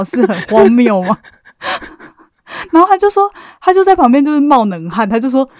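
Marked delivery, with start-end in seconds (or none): none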